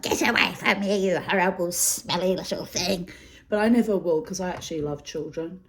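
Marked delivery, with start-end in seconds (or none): 0.0-3.1 put-on bitter old crone. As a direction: snap at imagined children, hammed-up. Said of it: "I'll be like".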